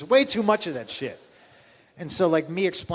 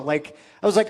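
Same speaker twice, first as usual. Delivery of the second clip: ordinary talk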